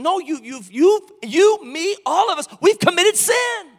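climbing to a full-voiced shout; rising shout. Real, not acted. Preacher to congregation, rising shout. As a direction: lift it into a shout on sin.

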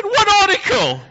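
high, loud startled question; mock surprise. Dad caught out by his son, high and loud. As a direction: squeal it in playful alarm. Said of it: "I said".

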